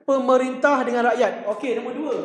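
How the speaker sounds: usual speaking voice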